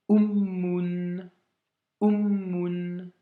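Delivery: "ummun" with a long held m in the middle; "ummun": "u", hold the m, then "un"; doubled m. Shown as a demonstration. Teacher models "ummun" for the student to repeat, long held m. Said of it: "Essaye de répéter derrière moi".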